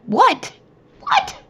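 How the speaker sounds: mock astonished double take, squeaking up; playfully acting stunned surprise; amazed disbelief. Imitating amazed self-talk.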